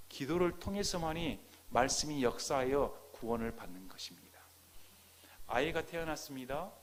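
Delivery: ordinary talk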